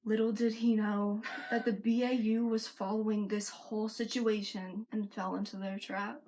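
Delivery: ordinary delivery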